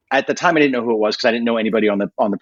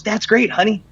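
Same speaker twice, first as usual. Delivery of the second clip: higher, sweet clueless mom voice; imitating mom; 9 semitones higher than usual. Mom answering her son's news, lightly mocked in a higher voice. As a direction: play his sweet, puzzled mother. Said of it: "And my mom goes".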